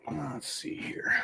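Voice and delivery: 살짝 혼잣말하듯 속삭임; whisper. Real, not acted. Streamer checking settings to himself.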